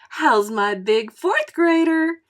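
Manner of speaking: high, slow, drawn-out gushing greeting; a teacher calling to her old pupil, high and gushing. Acted. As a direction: sing his name out like a delighted teacher. Teacher greets favorite student.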